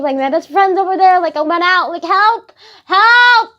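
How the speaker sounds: high pleading that builds to yelled cries for help; mock desperation. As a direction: escalate into a hammed-up call for rescue. Acts trapped plea, building.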